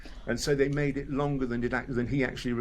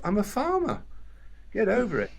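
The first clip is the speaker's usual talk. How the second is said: much higher, brushing it off; dismissive farmer; 9 semitones higher than usual. Retold or imitated. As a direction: shrug it off like a blunt farmer. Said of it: "that was David's attitude to it".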